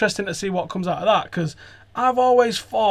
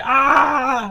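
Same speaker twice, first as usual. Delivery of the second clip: mock cry; 8 semitones higher, 4 dB louder than usual. Imitating the players' cry again.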